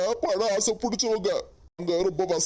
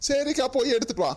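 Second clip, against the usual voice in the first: laughing; 4 semitones higher than usual. Acted. Groom laughing at sister.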